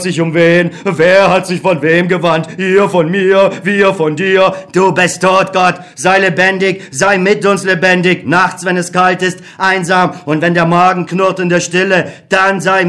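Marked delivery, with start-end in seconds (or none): none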